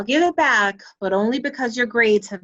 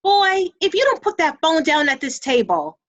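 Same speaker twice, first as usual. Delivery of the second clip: scolding mom; 2 semitones higher than usual. Acted. Mom warning her son off the phone, scolding.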